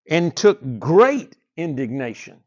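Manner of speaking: slow, loud and solemn reading of the verse; scripture reading. Acted. Preacher reads scripture aloud, slow and loud. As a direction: read it out slowly to the congregation. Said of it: "listen to these words".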